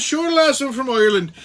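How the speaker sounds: high, lilting mock Irish reply; cheeky stage-Irish lilt; put-on Irish accent. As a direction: play up a fake Irish brogue. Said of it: "talking to him with my Irish accent".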